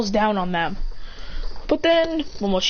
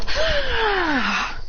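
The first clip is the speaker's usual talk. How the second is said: long, high mock yell gliding down; play-acted leaping attack cry; imitated battle cry; 11 semitones higher than usual. Imitated cry jumping at him.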